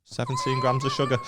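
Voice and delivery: laughing, a yelp of fake shock; hammed-up shock at a cereal box; mock amazement. A lad mocks himself reading cereal boxes, in laughing mock shock. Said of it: "I'm like".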